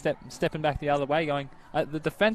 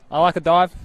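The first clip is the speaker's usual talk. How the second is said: excited; 4 semitones higher, 11 dB louder than usual. Real, not acted. Enthusing about a dive.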